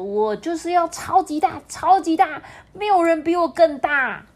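put-on high, loud, eager child voice; little chameleon boasting to dad; excited boast. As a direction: brag with all your might. Child boasts of growing huge.

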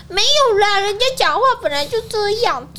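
high, sweet put-on girly voice; cutesy whiny voice. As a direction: coo it like a spoiled girl. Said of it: "开始在那边刁他呢".

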